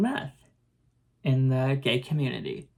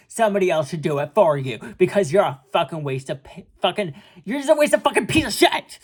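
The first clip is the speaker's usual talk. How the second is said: high-pitched acted rant, bursting louder at the end; angry tirade; 5 semitones higher, 3 dB louder than usual. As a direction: rattle off insults in exasperation, then erupt into a yell. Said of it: "I'm angry all the fucking time".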